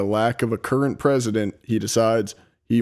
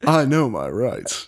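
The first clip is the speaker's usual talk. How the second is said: slower, smooth Southern drawl rising on I; cool and suave Southern suspect; slower than usual. Pretend. Host redoes Oswald line in a suave Southern drawl. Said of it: "Oh, nice".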